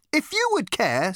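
high, strident interruption. A narrator's reading. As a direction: cut in stridently, pitched right up. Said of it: "said the girl with a strident voice".

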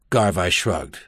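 plain reading voice

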